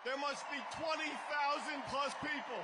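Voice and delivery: loud, high rally shout; shouting over crowd. Real, not acted. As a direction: call it out over the noise.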